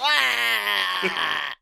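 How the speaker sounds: long high mock-disgusted wail; drawn-out wail. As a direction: let out a drawn-out "aah" of disgust. Mock disgust at Axe suggestion.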